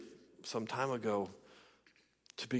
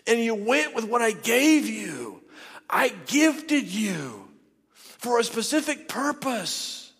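raised, slow, stretched-out declarations; fervent preaching; 8 semitones higher, 5 dB louder, slower than usual. Acted. Preacher voicing Jesus thanking a faithful church, fervent preaching. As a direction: proclaim it phrase by phrase, drawing out the words.

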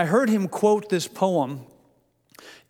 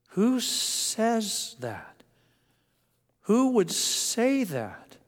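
much higher, very slow amazed question; incredulous; 6 semitones higher, much slower than usual. Real, not acted. Preacher asking the church who would say such words, amazed. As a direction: marvel at it, drawn out.

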